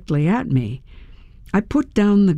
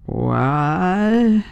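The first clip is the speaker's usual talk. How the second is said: put-on drooled, slurred drunk woman's voice; drunk slurring. A narrator's reading. Drunk stranger woken at the wheel, drunken slur. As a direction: let the word ooze out, barely formed. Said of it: "drooled out of her mouth".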